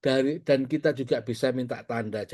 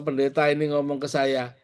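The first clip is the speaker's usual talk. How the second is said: usual speaking voice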